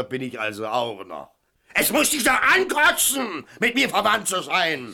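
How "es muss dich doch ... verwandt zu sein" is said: loud, high, bitter reproach; shrill, wounded anger; angry outburst. Acted. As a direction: throw the accusation in his face. Bitter family quarrel, shouting.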